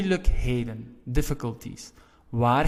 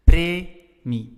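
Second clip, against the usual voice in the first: Premie with PRE higher and louder; stress on first syllable; 4 semitones higher, 8 dB louder than usual. Shown as a demonstration. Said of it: "stress is on the first part".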